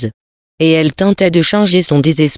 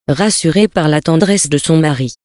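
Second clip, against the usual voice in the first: ordinary delivery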